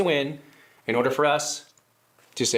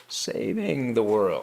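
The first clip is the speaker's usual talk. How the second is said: slow, sarcastic emphasis on saving; scare quotes; 5 semitones lower, much slower than usual. Acted. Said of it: "what's up with those scare quotes".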